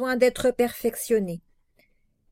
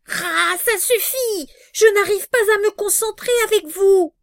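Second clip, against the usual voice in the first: crie, énervé et rapide; en colère; 6 semitones higher, 6 dB louder than usual. Real, not acted. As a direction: explose d'agacement. [name] s'énerve contre eux. (colère criée)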